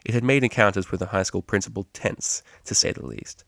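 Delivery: usual speaking voice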